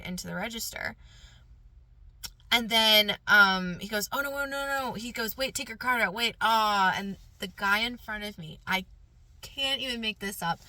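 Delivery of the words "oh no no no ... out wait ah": imitating someone hurriedly objecting, pitched up; flustered interruption. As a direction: cut in with a mild mock panic. Acting out flustered cashier's interruption.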